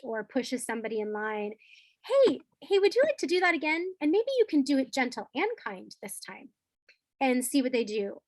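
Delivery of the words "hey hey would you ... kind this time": put-on bright, sing-song correction to a child; playful teacher voice. Shown as a demonstration. Teacher to a pushy kid, playful and higher. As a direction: coax a kid to retry, higher and light.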